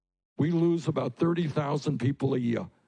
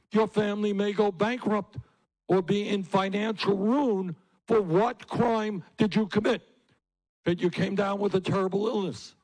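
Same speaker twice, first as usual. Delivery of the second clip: outraged; 7 semitones higher than usual. Real, not acted. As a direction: hammer home the injustice to the crowd. Outrage at medical bankruptcy.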